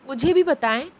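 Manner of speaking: ordinary delivery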